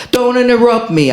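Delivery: yell. Acted. Poet acting out a fight with a woman, high yell. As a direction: snap it out fast, up high. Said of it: "I yell".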